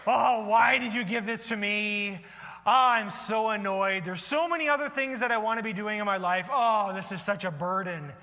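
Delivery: put-on put-upon, eye-rolling teenage grumble; whiny teenager. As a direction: groan it out like an annoyed teen. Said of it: "Like a teenager, you know, rolling their eyes".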